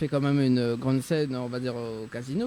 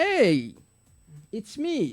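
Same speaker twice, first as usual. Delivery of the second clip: a bright, higher teasing "hey, it's me"; playful mock intro; 8 semitones higher, much slower than usual. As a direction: tease her like a pop star saying hello. Playful catchphrase about Amy's name. (présentation taquine de star, voix plus aiguë)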